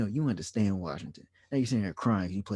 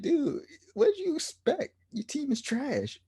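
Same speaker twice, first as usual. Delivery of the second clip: mocking rant. Mad rant quote to Beal.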